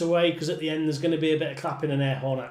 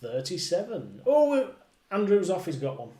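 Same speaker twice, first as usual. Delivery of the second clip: bingo caller; 3 semitones higher than usual. Acted. Calling bingo numbers with rhymes.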